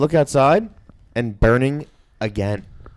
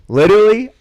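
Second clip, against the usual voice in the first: ordinary talk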